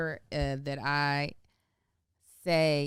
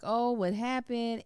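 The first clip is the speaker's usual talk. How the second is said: slow, higher, gentle comfort; soothing mom; 7 semitones higher, slower than usual. Retold or imitated. Mother comforting her crying son, gently. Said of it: "being like".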